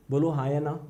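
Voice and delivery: ordinary delivery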